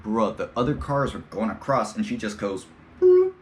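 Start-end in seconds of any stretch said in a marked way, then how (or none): 3.0-3.3 high, loud imitated horn; horn beep. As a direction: squeak out a car horn. Imitating car horn sound.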